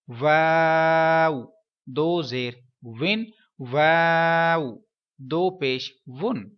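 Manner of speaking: slow spelled recitation; spelling drill. Shown as a demonstration. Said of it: "واو کے میں ہجے کر دیتا ہوں". (واو کو لمبا کھینچ کر ہجے)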